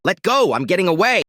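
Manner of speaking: angry shout. Acted. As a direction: shout to break free of her grip. Pulling free from wife's grab.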